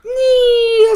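very high, whiny mock squeal; anime girl squeal. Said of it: "like, anime girl".

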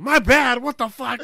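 loud, high mock outburst; outraged superior. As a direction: throw the words back in indignant disbelief. Superior's angry reply acted out.

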